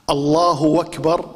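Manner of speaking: الله أكبر said as اللهوَكبر, slow, a w slipped before أكبر; اللهوَكبر, the Kuwaiti slip; wrong w sound. Shown as a demonstration. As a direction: glide into a w between الله and أكبر. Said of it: "بالواو".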